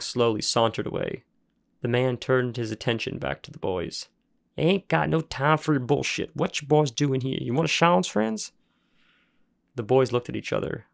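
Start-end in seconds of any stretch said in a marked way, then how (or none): 4.6-8.5 higher, rough backwoods worker voice; impatient rural grump; gruff junkyard man. Acted. Junkyard man grilling the boys, gruff and impatient.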